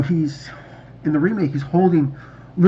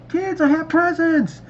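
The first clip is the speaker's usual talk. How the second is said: put-on slow, much higher, delighted call to the children; excited announcement; 11 semitones higher than usual. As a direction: announce the presents like a thrilled dad. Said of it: "He's like".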